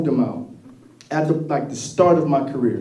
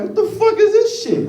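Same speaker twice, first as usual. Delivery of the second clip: high mock-outraged old man squawk; angry dad; 4 dB louder than usual. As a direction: play the dad's shocked outrage, pitched way up. Dad angry at vegan store.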